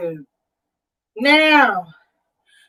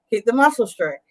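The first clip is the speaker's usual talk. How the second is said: normal talking voice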